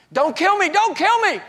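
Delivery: high, loud panicked cry for mercy; desperate shout for his life; terrified yell. Retold or imitated. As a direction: scream it out in terror. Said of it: "I yelled".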